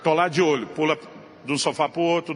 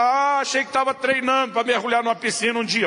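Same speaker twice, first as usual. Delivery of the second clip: sarcastic retort; 6 semitones higher than usual. Retold or imitated. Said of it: "eu quero abrir a boca e gritar".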